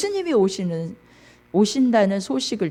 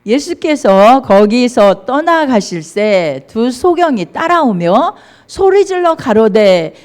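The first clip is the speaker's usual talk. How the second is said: scripture reading; 3 semitones higher, 10 dB louder than usual. Real, not acted. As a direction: lead the congregation in reading aloud, forcefully. Leads congregational Bible reading aloud. (크고 또박또박한 성경 봉독)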